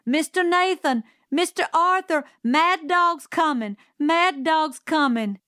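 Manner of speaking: high, loud alarm call; shouting. A narrator's reading. Calpurnia shouting a mad dog warning at the Radley door, loud and high. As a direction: bang out the warning to the house. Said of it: "and she shouted".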